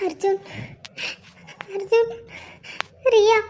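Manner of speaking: soft words broken by sobs; crying. Acted. Wife tries to tell her husband about the baby, sobbing. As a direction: struggle to speak through tears. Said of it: "இப்ப எதுக்கு அழுவுற".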